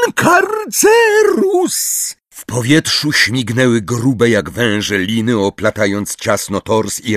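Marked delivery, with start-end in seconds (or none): 0.1-2.2 very high, drawn-out shriek; Umbridge. A narrator's reading. Umbridge casts at Magorian, shrieking. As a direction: scream the spell slowly. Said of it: "wycelowała różdżkę w Magoriana i wrzasnęła".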